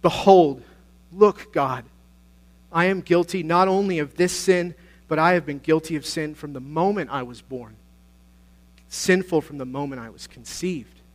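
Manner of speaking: loud, emphatic outcry easing as it goes; emphatic cry. Retold or imitated. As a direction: cry out to God in confession, strongest at the start. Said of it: "he cries out with this emphatic declaration".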